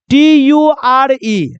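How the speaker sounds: टी यू आर ई spelled out letter by letter, very slow; slow spelling. Shown as a demonstration. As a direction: spell out टी यू आर ई slowly for students. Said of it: "आप ऐसे समझ लो".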